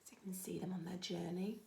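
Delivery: quiet whispered narration; a secretive storyteller's whisper; whispered aside. A narrator's reading. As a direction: lean in and whisper to the children.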